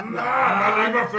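1 laughing. Real, not acted. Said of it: "Du tust immer so flüstern".

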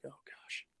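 barely audible, whispered dread; nervous whisper. Retold or imitated. Nervous skater is called over by the boss, whispering. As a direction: whisper it to yourself as you panic.

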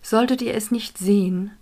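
calm, unhurried guiding voice; guided meditation. Acted. Leads a meditation.

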